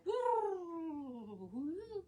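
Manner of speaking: sung-out swooping "woo", rising high; pretend magic effect. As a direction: voice a wavy flashback sound effect. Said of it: "Pretend we did the visual effects".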